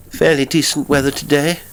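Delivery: small talk with a slightly nervous edge; touch of nervousness. A narrator's reading. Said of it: "a touch of nervousness".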